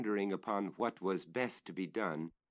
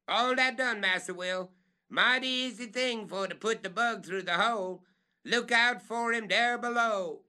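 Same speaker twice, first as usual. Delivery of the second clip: much higher, louder call; 13 semitones higher, 6 dB louder than usual. Acted. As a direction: warn those below.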